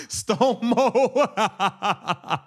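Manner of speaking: laughing through the name; cracking up at a fan's joke. Real, not acted.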